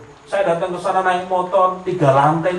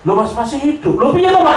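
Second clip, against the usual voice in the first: astonished villagers; 8 semitones higher than usual. Retold or imitated. Villagers shocked he lived, astonished cry. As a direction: ask as if seeing a ghost.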